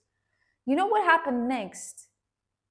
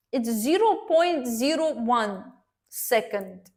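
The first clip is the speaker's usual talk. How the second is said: normal talking voice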